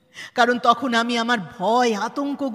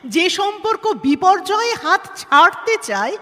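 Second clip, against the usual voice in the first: higher, louder indignant rebuke; heated challenge; 9 semitones higher, 7 dB louder than usual. Acted. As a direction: throw the question back at her hard. Bitter challenge about the marriage.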